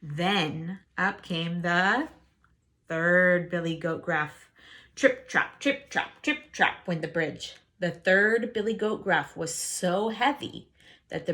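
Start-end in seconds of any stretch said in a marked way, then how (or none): none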